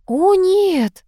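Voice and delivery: high, drawn "oh no"; flustered. A narrator's reading. Hostess hurrying to stop the departure, flustered. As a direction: protest in a fluttery high pitch. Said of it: "засуетилась Богемская".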